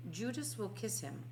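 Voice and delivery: plain reading voice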